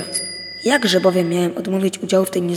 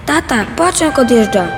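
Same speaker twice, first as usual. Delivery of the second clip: put-on higher, louder child's call; a small boy shouting on the platform; shouting son; 5 semitones higher, 6 dB louder than usual. A narrator's reading. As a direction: call out to dad that the train's leaving. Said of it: "krzyknął synek".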